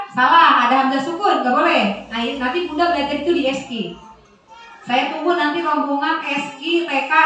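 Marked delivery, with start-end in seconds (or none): none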